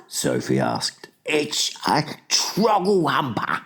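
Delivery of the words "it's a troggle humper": high, slow anguished cry; fury and anguish. A narrator's reading. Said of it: "filled with fury and anguish".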